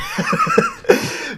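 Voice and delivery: squealing high laugh; high-pitched laugh burst. Real, not acted. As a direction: crack up at your own joke. Laughing at own impression.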